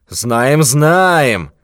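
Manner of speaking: scoffing repeat; mock his excuse. Thief mocks old miser's claim, scoffing. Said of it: "насмешливый ответ".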